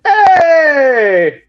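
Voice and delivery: imitated high, loud oblivious holler; deaf dad's shout. Deaf dad answering customers calling him, high hollered greeting. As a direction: holler back like someone hard of hearing. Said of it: "My dad's deaf in his left ear".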